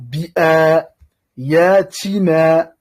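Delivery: bi'a puis yatina, très lents, le a tenu deux temps; slow careful reading. Shown as a demonstration. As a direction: lis bi'a yatina lentement, syllabe par syllabe. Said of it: "deux temps". (lecture lente et appliquée, a prolongé)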